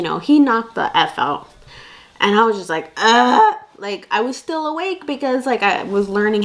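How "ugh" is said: a short, louder groan; mock worn-out ugh; tired groan. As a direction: groan of exhaustion. Exhausted groan quoted.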